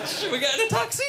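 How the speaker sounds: high, eager, laughing; excited mock question. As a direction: ask it all keyed up, half-laughing. Acted quote, big pitch jump.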